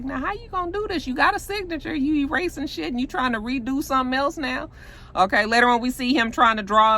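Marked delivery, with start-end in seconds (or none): 0.0-4.7 high-pitched disbelieving questioning; incredulous challenge. Retold or imitated. Viewer reacting to Andre redoing the deal, incredulous.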